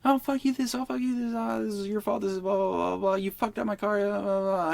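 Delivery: high, whiny imitation of an angry driver; mock angry cursing. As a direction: ham up the other driver's high-pitched rant. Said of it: "he's, like, cursing at me".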